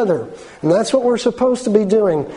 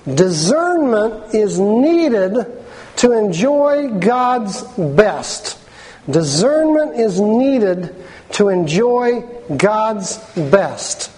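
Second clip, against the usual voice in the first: slow emphatic; much slower than usual. Acted. Preacher stressing a universal truth.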